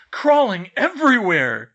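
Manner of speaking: very loud, high outburst; rattled exclamation. A narrator's reading. George adds to the moth report, loud and rattled. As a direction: add it in a loud, high burst.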